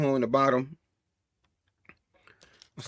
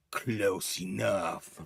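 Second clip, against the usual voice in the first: much lower and softer, very slow; a chilling low taunt; slow low menace; cold low reply; 8 semitones lower, 11 dB quieter, much slower than usual. Acted.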